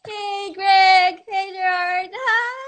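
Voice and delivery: high, drawn-out hellos; sing-song greeting. Real, not acted. Saying hey to Greg and Gerard from California, sing-song. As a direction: greet each friend in a high, singing lilt.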